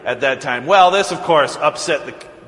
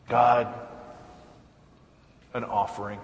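quietly; 4 semitones lower, 7 dB quieter than usual. Retold or imitated. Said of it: "he kind of says quietly".

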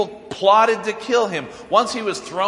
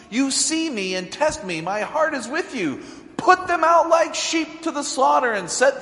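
rising, louder, higher lament that builds to outrage; angry complaint to God; 5 semitones higher, 4 dB louder than usual. Retold or imitated. Jeremiah accusing God, in a heated rising lament. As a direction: plead your case to God, heating up as you go. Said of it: "he starts to get a little angry".

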